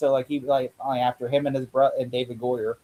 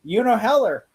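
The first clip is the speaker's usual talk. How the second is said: excited execs; 6 semitones higher than usual. Retold or imitated. Voicing excited studio execs.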